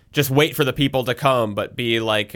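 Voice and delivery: normal talking voice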